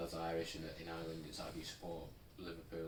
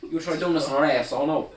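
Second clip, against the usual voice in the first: put-on loud, high Young Offenders Irish; Irish accent; 6 semitones higher, 15 dB louder than usual. Mimic getting into the accent, loud Irish. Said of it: "get into that accent".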